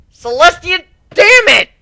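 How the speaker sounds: high, loud cursing yell; defeated guard curses in anger; furious outburst. Acted. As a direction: explode in frustration.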